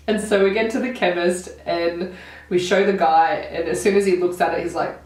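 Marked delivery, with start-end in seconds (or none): none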